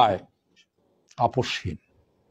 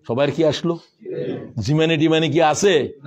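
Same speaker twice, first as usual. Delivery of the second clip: usual speaking voice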